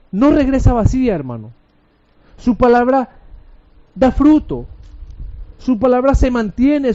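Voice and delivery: high-pitched, emphatic sermon voice; impassioned preaching. Real, not acted. Preacher proclaiming God's word to the congregation, impassioned preaching. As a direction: proclaim it in rising, forceful bursts.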